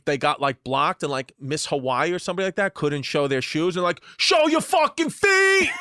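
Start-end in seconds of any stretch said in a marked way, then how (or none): 4.2-5.7 high, loud, drawn-out yell; screaming heckler. Retold or imitated. Creeps heckling a pageant contestant at the shoe parade, screaming. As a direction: scream it out like a rowdy mob at a parade. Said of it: "They were, like, screaming at her".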